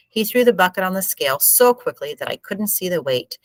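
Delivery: ordinary delivery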